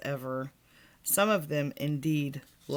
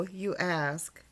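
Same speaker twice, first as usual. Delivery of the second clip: ordinary delivery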